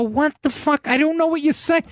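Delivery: mock exasperated confusion; baffled reply. Retold exasperated reply to attendant.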